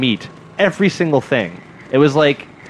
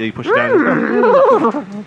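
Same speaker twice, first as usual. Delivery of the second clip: horse whinny; 4 dB louder, much slower than usual. Pretend. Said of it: "I can't do it very well".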